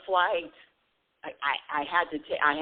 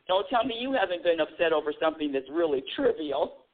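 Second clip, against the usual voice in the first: usual speaking voice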